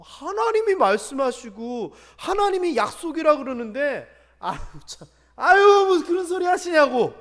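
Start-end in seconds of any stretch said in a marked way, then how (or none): 4.4-7.1 loud laughing scoff. Retold or imitated. Abraham laughing off God's words, loud scoff. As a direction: brush God off with a laugh. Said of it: "웃어요".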